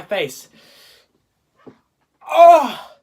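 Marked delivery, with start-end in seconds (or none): 2.3-2.9 loud cry of pain; sudden loud oh of pain. Real, not acted. Burning pain peaks.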